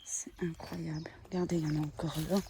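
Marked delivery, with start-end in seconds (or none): 0.0-1.1 whispering. Real, not acted. Awed on finding abandoned house.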